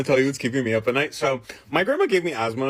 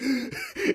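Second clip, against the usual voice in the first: high, imitated gasp for air; mimed breathless gasp; struggling gasp; 4 dB quieter than usual. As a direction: act out lungs tightening with a gasp. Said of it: "I can't breathe anymore".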